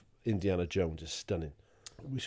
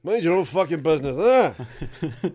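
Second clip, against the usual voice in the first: put-on louder, slurred drunken snap; drunk snarl; 7 semitones higher, 9 dB louder, slower than usual. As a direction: slur it like a drunk. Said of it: "He just stayed at the bar".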